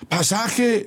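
high-pitched fare call. A narrator's reading. Fare collector to passenger hanging from door, calling out.

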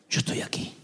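breathy whisper, close and soft; God's whisper. Shown as a demonstration. Preacher voicing God's whisper to a weary listener, whispered. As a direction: whisper it like God at your side. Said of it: "el susurro de Dios siempre te demuestra".

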